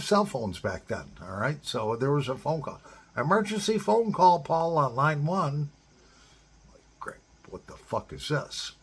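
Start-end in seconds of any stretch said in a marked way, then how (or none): 3.2-5.7 a mock overhead page for Paul; intercom page. 7.0-8.7 muttered aside. Retold or imitated. His annoyed reaction to page.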